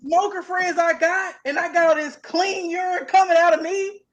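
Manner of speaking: loud and high, selling the idea hard; excited pitch. Real, not acted. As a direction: hype up the plan.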